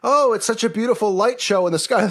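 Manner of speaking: high, gushing mock praise; mock admiration. Crowd admires the launch lights, high mock gushing. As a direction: gush over the light show. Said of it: "and everybody goes".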